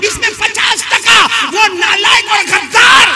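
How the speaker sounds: high sermon voice building to a shout; full-force preaching. Real, not acted. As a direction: challenge the crowd, ending in loud condemnation. (मजमे को ललकारती बुलंद तक़रीर)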